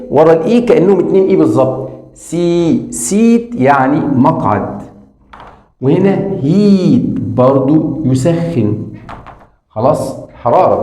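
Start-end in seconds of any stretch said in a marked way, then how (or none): 2.1-3.5 سيت said slowly, ee stretched; slow drill for the class; slow long ee demo. Shown as a demonstration. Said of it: "هنمد الإي". 5.8-8.8 سيت said slowly, ee stretched; slow drill for the class; slow long ee demo. Shown as a demonstration. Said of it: "هنمد الإي".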